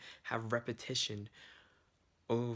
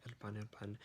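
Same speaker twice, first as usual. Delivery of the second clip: "el pan, el pan" repeated much softer; softer drill repetition; 8 dB quieter than usual. Shown as a demonstration. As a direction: murmur the word over to memorize it. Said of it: "so I'm memorizing bread".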